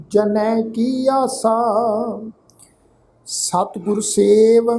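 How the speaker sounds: slow, drawn-out sacred reading; scripture recitation. Shown as a demonstration. Teacher models the scripture reading for the congregation, slow recitation. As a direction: recite it slowly, holding the long vowels.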